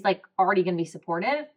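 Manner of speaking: ordinary talk, nothing marked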